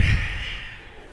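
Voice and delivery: acted-out heavy exhale; mock wife's wordless sigh; exasperated sigh. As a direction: let out a big fed-up breath. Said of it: "she's standing there like".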